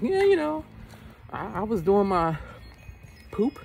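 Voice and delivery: high silly voice, lightly done; mock voice. Bleeping out a swear word, high silly voice. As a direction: say it like a kid avoiding swearing.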